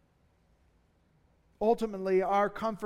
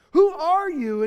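loud, high challenge of "Who are you?"; demanding crowd; 6 semitones higher, 9 dB louder than usual. Acted. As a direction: press him hard for an answer.

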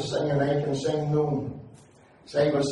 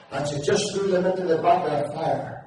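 ordinary talk

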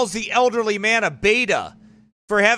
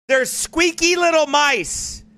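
ordinary delivery

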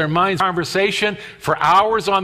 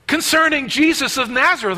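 higher-pitched exasperated reply; irritated; 7 semitones higher than usual. Acted. Cleopas answering the stranger's question, irritated. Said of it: "I can actually see the irritation".

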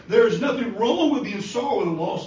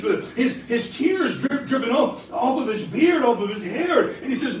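high, ringing preaching voice; fervent preaching; 6 semitones higher, 3 dB louder than usual. Acted. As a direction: preach the scene with fire. Preaches the raising of Lazarus.

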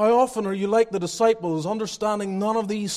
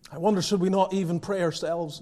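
ordinary talk, nothing marked

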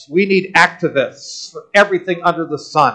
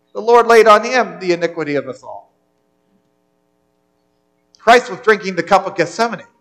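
ordinary delivery

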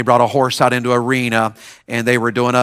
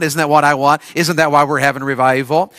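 normal talking voice

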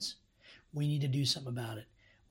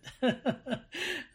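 high laugh at one's own impression; self-amused laugh; 12 semitones higher than usual. Real, not acted. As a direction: burst of real, embarrassed-at-oneself laughter.

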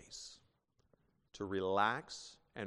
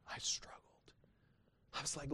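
whispered; 5 semitones higher, 7 dB quieter than usual. Real, not acted. Preacher recalling struggle.

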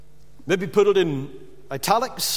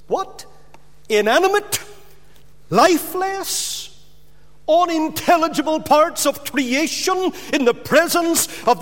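astonished; 7 semitones higher than usual. Real, not acted. Preacher's astonished outburst.

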